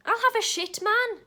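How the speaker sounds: loud, high-pitched proclamation; defiant declaration. Acted. Rejecting proper men for another sort, loud and defiant.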